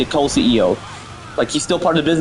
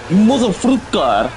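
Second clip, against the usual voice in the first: dad stuck in traffic; 5 semitones higher, slower than usual. Real, not acted. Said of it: "if you have road rage".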